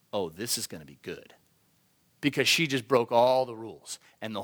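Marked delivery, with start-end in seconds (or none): 0.1-1.3 low, hushed gloating aside; gloating anticipation. Acted. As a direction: murmur it like an onlooker relishing trouble. Men eagerly watching her break rules.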